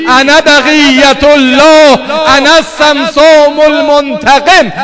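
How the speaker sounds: loud, high, keening chant of grief; mourning preacher crying out to God in prayer; wailing lament. Acted. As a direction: cry it out over the crowd at full force. Full-force wailing lament, prayer.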